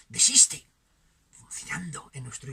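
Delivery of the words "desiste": sudden loud, high command; vociferous inner voice. Acted. Inner voice ordering oneself to give up, shouted. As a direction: burst out with a sharp order. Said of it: "declaraciones vociferantes".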